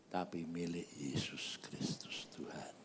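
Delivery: low whispered declaration; soft, low and almost all breath; hushed reverence. Real, not acted. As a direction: drop to a solemn whisper. Preacher drops to solemn hush.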